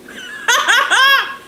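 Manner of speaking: a burst of high, loud laughter. Real, not acted. Vlogger laughs at her own childhood story, high cackle. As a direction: cackle at the memory.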